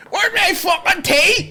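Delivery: put-on high, slow, crying shout of a hungry baby; dad voicing his furious baby son; angry baby voice. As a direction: voice the toddler demanding his food. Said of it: "now he's like".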